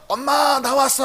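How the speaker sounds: high-pitched call home to mom; drawn-out mock shout. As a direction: imitate a son hollering as he arrives, without real volume. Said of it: "이렇게 소리 지르면서 오는데".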